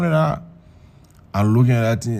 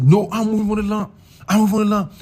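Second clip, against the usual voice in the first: higher-pitched, heated protest; indignant refusal; 8 semitones higher than usual. Retold or imitated. Man telling airport official he's moving on, indignant.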